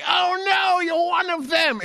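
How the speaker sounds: loud, high-pitched American-accent impression; mock American accent. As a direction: exaggerate a bad American accent, shouted. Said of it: "it just doesn't sound American".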